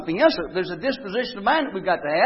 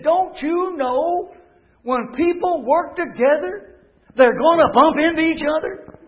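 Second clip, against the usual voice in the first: earnest rebuke; 6 semitones higher, 5 dB louder, slower than usual. Retold or imitated. Brother's wise rebuke retold.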